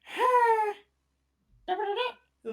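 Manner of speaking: a tiny exasperated huff; spider sigh. Acted.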